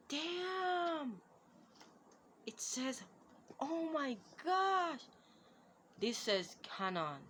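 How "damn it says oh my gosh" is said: high, stretched gasps of delight; can't contain the thrill; excited exclamations. Real, not acted. Reading the box label aloud, high excited gasps. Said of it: "I'm excited".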